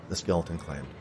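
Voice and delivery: plain reading voice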